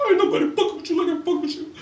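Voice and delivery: hammed-up crybaby wail on Ain't nobody fuck with you; mock crying voice. Shown as a demonstration. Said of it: "I gotta do it in my crime voice".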